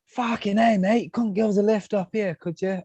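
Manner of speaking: a bad mock-Aussie hitchhiker begging a lift; awful Aussie impression. Hitchhiker Ryan asking for a lift, in an awful, hammed-up Australian accent. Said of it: "I know that was an awful impression".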